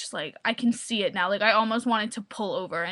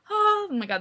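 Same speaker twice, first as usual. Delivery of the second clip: excited gasp; 7 semitones higher than usual. Real, not acted. Suddenly remembering a favourite scene.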